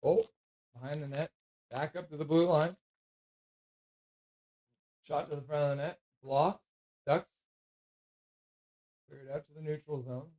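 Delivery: casual live call of the game; hockey play-by-play. Acted. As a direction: narrate the play like a relaxed announcer.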